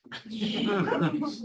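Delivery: real amused laugh rising. Real, not acted. Speaker laughing at the patient's autopsy mix-up. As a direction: laugh at the joke.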